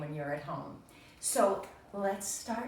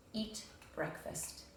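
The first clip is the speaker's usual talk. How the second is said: soft slow whisper; whispered list item; 3 semitones higher, 10 dB quieter, slower than usual. Acted. Teacher reading Toad's crossed-off item in a slow whisper. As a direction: breathe the item out.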